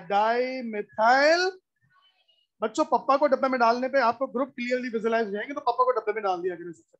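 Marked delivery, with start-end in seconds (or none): 0.1-1.5 drawn-out syllables, rising at the end; slow dictation. Real, not acted. Teacher writes the name as students follow, slow dictation. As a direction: stretch it out and leave it hanging.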